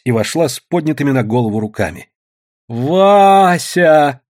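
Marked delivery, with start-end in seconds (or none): 2.7-4.2 loud, high drawn-out call of his name; raised high call. A narrator's reading. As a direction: shout his name across the yard. Woman calls out to him.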